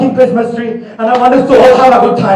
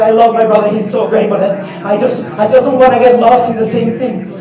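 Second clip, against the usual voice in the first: ordinary talk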